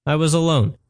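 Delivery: usual speaking voice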